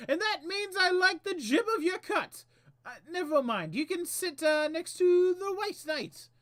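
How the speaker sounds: high, loud, theatrical Hatter; Mad Hatter voice. Acted. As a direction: play the fussy Mad Hatter. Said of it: "he says".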